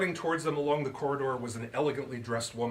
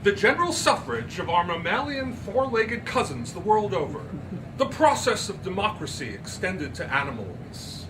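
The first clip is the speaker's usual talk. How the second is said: much higher, louder, grand and proud; haughty society lady; 7 semitones higher, 6 dB louder than usual. Acted. Miss Turpin boasting to Andrew, haughty and high. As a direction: play the painted secretary with relish.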